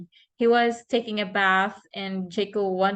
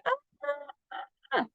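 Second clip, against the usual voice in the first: soft high mock scream of ah, ah; child's imitated scream; 6 semitones higher, 7 dB quieter, much faster than usual. Mom imitating her son's scream in the song, soft high squeal. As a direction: squeal ah, ah softly like a toddler. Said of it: "And Jacob would say".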